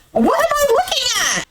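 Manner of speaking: high-pitched disbelieving exclamation; incredulous outburst. Real, not acted. Recapper reacting to him spoon-feeding his ex, incredulous.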